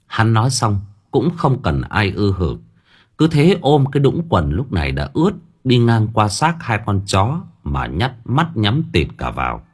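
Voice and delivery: ordinary delivery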